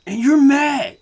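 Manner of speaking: shouting mad about the jackpot; furious rant. Acted. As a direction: yell it out in outrage. Ranting about losing at slots.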